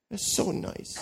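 breathed out as a dry sarcastic aside; sarcastic whisper. Acted. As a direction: whisper it, mocking the fake piety. Sarcastic aside about scheming son.

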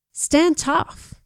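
high, urgent command to herself; a hint of a drill order; inner order. A narrator's reading. Her brain orders her body, mildly commanding. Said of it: "my brain ordered".

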